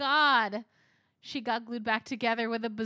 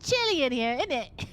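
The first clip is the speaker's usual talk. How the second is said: playful copy of a Cockney character; Cockney accent; 4 semitones higher, 6 dB louder than usual. Retold or imitated. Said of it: "would hundred percent do the accent".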